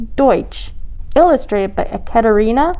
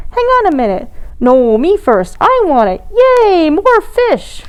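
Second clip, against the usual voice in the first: high, excited grabby voices; squabbling little penguins; 7 semitones higher than usual. A narrator's reading. Polly grabs fishy snacks, high and greedy. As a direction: rise into a greedy cheer.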